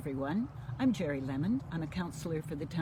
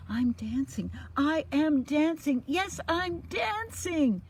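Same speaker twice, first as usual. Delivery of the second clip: higher, slow, rising delight; joyful cry; 12 semitones higher, 8 dB louder, slower than usual. Acted. Gerald finally dancing, crying out with joy. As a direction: cry out in wonder. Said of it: "Gerald cried".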